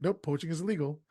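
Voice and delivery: a fast, flat brush-off denial; said really quickly. Retold or imitated. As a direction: rattle it off before anyone can doubt you. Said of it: "He says really quickly".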